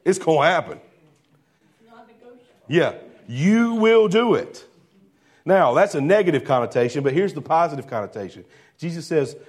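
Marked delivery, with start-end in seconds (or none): 2.7-4.7 higher, slow, stress on will; emphatic you will. Shown as a demonstration. Preacher demonstrating the emphatic verb, in a slow emphatic insistence. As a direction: draw out will so there's no arguing. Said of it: "what does that "you will" mean?".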